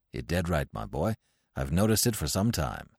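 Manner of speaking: low, soft doting father; low fond father humouring his son; father's voice. Acted. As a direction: coo it down low to the child.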